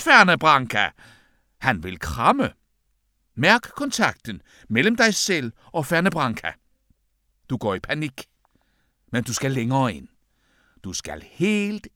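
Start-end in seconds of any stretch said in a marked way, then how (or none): none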